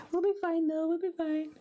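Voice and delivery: high, singsong reassurance; chirpy and high-pitched, after a laugh; high self-reassurance. Real, not acted. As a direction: brush off a mistake in a high little voice. Reassures self after wonky stamping.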